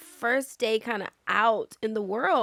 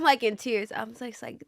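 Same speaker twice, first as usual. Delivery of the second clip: ordinary delivery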